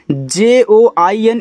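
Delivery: spelling out letters. Shown as a demonstration. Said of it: "spelling out letters slowly".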